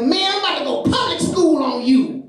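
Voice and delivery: high-pitched mock-angry threat; mad kid. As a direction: imitate an angry schoolboy squaring up. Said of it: "He gets real mad".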